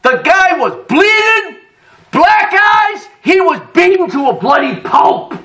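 high, slowed, near shouted telling; excited telling. Real, not acted. Preacher tells the congregation how the challenger came back beaten, excited. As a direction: build it up in disbelief.

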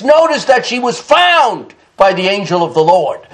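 ordinary talk, nothing marked

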